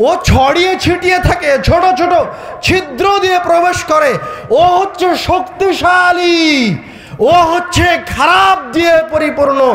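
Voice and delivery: high-pitched, ringing sermon climax; full-force preaching. Real, not acted. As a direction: pound out each charge, stretching the key words.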